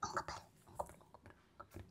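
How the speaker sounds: unvoiced hushed whisper. Real, not acted. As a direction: whisper so the camera won't hear. Secretly pointing out camera.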